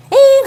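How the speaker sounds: 아이의 짧고 높은 "엥" 울음 흉내; a sharp high baby whimper; imitated whine. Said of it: "하고 울걸요". (높은 아기 울음 흉내)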